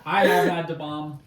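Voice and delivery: laughing through the words; laughing loudly. Real, not acted. Laughing while recalling the bomb.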